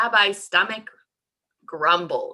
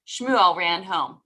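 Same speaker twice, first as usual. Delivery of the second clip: plain reading voice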